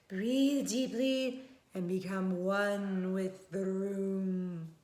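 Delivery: slow, soothing trance voice; hypnotic therapist. Acted. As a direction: draw the words out to hypnotize.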